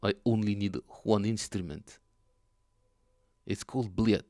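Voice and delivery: loud, hammed-up Russian accent; booming mock Russian man. Quoting a chat viewer in a mock Russian accent. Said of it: "I hope you don't mind the accent".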